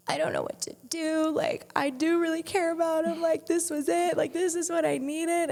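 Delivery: high, pleading reenactment; retold distress. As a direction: act out an overwhelmed confession to a sister. Retold distraught phone call to sister.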